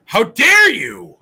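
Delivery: loud, slow, high how dare you; hammed-up indignant bellow; mock outraged shout. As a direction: roar it like an offended cartoon. Repeats the catchphrase performed.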